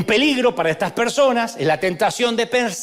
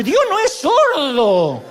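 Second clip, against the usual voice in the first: riendo irónico; 6 semitones higher, 3 dB louder, much slower than usual. Real, not acted.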